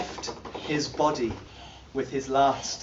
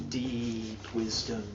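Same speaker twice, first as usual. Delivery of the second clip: much lower, softer and slow; deep intoning; 6 semitones lower, 12 dB quieter, slower than usual. Acted. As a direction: sink into the words. Dramatic pause on wisdom.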